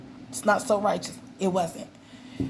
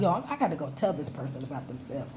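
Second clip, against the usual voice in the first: lower, softer, fast muttered aside; 5 semitones lower, 8 dB quieter, faster than usual. Retold or imitated. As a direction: grumble it under your breath, quickly. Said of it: "I'm like".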